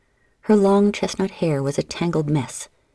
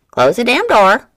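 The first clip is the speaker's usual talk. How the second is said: louder, higher squawking bird; parrot squawk; 8 semitones higher, 9 dB louder than usual. A narrator's reading. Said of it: "Checkmate squawked".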